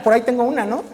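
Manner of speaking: normal talking voice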